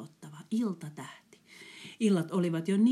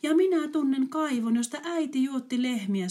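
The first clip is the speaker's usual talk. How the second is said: high, loud eager recognition; the girl remembers her mother's well; 8 semitones higher, 6 dB louder than usual. Acted. (innokas tyttö)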